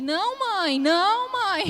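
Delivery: put-on high, loud child's plea; child protesting. Mother voicing her son begging not to lose his milk, high and loud. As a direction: cry out like a little boy begging.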